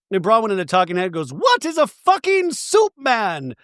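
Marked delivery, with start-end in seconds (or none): 1.3-3.5 high, drawn-out mock wail; exasperated disbelief. Mimicking Bronwyn in her talking head, exasperated disbelief. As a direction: stretch the question into an incredulous squeal.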